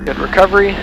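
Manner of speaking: usual speaking voice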